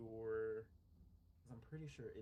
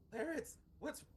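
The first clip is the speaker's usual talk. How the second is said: calling out; 13 semitones higher than usual. Acted. Calling to injured companion.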